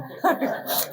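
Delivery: short airy chuckle at his own fib; soft unvoiced laugh. Real, not acted. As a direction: breathe out a small laugh.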